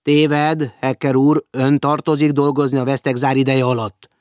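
loud, stern official rebuke. Acted. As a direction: lay down the rule with cold authority. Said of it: "mondta szigorúan a másik".

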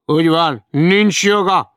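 high, loud, slow solemn agreement; old newsvendor solemnly backs Hecker, loud and slow; old newsvendor agreeing. Acted. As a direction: declare it like a self-made lawyer. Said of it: "mondta az öreg hírlapárus".

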